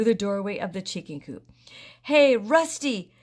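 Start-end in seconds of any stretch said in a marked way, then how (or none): 2.0-3.1 loud, slow, drawn-out yell. A narrator's reading. Sam yelling at Rusty while chasing him. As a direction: bellow his name as you charge. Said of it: "yelled Sam".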